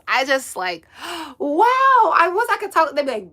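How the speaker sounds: usual speaking voice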